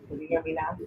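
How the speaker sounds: faint, distant voice off the mic; far from mic. Real, not acted. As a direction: speak from too far away. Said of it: "Your headphones still got you low".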